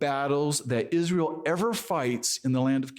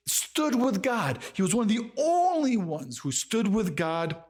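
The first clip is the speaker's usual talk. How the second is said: high-pitched, disbelieving questions; incredulous amazement; 7 semitones higher than usual. Acted. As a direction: react in astonishment, pitch climbing as the questions pile up. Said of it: "you gotta go".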